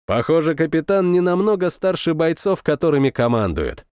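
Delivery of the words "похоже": ordinary delivery, nothing marked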